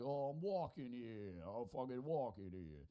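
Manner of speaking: put-on drawn-out Hoboken, New Jersey accent; mock Jersey accent, slowed down. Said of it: "Not like a Hoboken-esque one where they're like".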